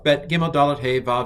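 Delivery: normal talking voice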